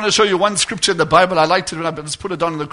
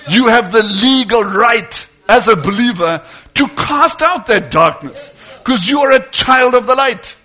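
louder, driving sermon cadence; preaching at full force; 4 semitones higher, 7 dB louder than usual. Acted. Preacher urging believers to use their authority, full force. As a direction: preach it hard to the congregation.

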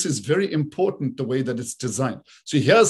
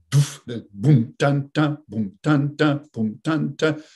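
vocal oom-pah-pah drumbeat, accented "boom" then two "dun"s; waltz beat demo; slower than usual. Shown as a demonstration. Showing listeners the emphasis on the first, waltz beat demo. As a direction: imitate a waltz drum, hitting the first beat. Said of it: "the emphasis is on the first".

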